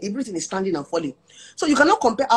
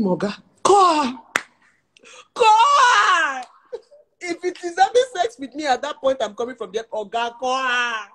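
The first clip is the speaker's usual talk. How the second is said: high, slowed, shouted dare; mock outraged dare; 6 semitones higher, slower than usual. Wife daring her husband to call his side chick, mock shriek. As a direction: dare him, half-shrieking.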